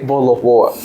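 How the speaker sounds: casual London-style accent, T dropped in "water"; at-home British accent. Shown as a demonstration. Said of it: "When they are at home".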